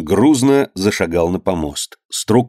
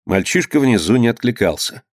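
ordinary delivery

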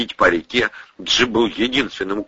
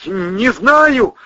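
raised voice, chattering teeth; 5 dB louder than usual. Acted. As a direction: shiver through the words. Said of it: "лязгая зубами".

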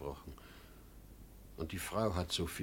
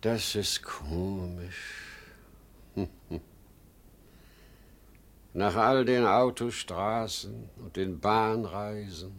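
normal talking voice

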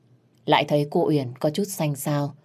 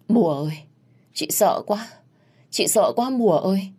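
acted frightened plea, pitched up; tearful fear; 5 semitones higher than usual. A narrator's reading. Chị Hồng clings to Mùa after the confrontation, tearfully afraid. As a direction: plead for comfort, mildly whimpering. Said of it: "khóc mếu".

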